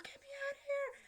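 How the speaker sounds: very high, squealed cry to be let out; retold scream. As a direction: re-enact panicked screaming, not at full volume. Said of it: "I'm screaming".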